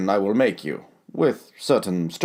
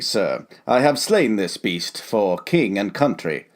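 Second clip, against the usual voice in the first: ordinary delivery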